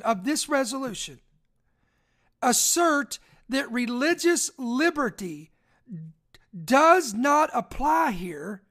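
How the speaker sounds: slow and drawn out, long pauses between phrases; slow reread. Shown as a demonstration. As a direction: spell it out for the late listeners. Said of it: "let me read this one more time".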